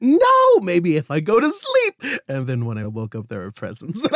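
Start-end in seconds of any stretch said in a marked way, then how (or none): 0.0-2.2 put-on young self worrying. Child self talking to himself.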